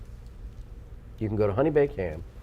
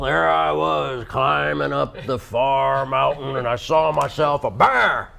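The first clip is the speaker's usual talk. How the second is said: mountain man voice; slower than usual. Pretend. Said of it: "mountain man impression".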